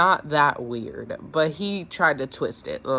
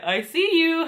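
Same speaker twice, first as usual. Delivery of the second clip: high, loud sing-out with a laugh after; jokey "spotted you" holler; playful teasing; 12 semitones higher, 5 dB louder, slower than usual. Retold or imitated. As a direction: call it out teasingly, pitched way up. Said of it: "Hey, I'm passing by your job".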